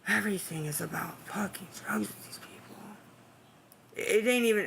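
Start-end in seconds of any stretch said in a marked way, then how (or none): 0.1-2.8 muttered under the breath, much softer; whispered aside. Real, not acted. Streamer grumbling to herself about viewers, whispered. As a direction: grumble it to yourself so chat barely hears.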